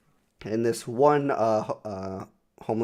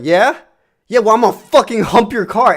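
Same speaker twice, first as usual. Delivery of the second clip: loud, high mock yell of an angry hobo; 10 semitones higher, 11 dB louder than usual. Hobo yelling at the driver who refused money. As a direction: bark it like an offended hobo.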